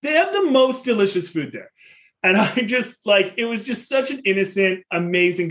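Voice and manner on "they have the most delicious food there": put-on disappointed kid. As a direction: let it sag like a let-down little boy. Son repeats his lament.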